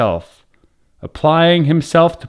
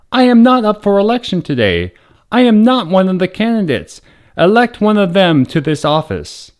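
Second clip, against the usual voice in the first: shouting over the din; 6 semitones higher than usual. A narrator's reading. Governor protesting to the chanting crowd, raised voice. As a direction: push your voice over the noise. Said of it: "he tried to shout over the din".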